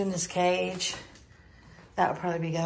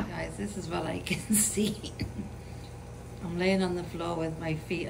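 hushed aside; 5 dB quieter than usual. Real, not acted. Lying on floor, hushed.

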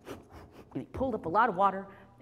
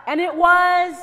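high, loud, stretched words; exasperated build-up; 11 semitones higher, 18 dB louder, slower than usual. A narrator's reading. Storyteller builds to the noisy refrain, shouting. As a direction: shout it over the din.

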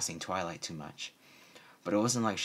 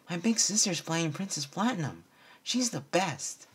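proud little filly; 10 semitones higher than usual. A narrator's reading. Sweetie Belle bragging about her sister, high and proud. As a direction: boast about your big sister.

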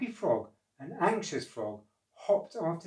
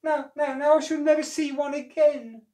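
high, loud wail of mock sobbing; a hammed-up sob over the lost egg; sobbed; 14 semitones higher, 9 dB louder than usual. Elf mourns stolen egg.

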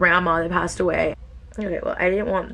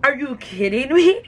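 1 mock disbelief; 7 semitones higher, 3 dB louder, slower than usual. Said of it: "cliffhangers where I'm like".

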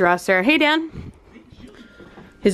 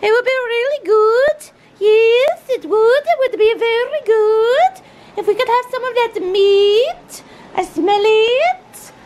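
high-pitched talking-dog voice; doggie voice; 13 semitones higher, 4 dB louder than usual. Acted. Woman speaking for the doggies begging for meat, high dog voice. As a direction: beg for food like a high-voiced pet dog. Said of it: "The doggies are saying".

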